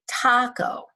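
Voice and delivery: taco with a strong, high TA; stress on first syllable. Shown as a demonstration. Teacher confirms how we say taco, stressing TA. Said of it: "So the stress is on the A".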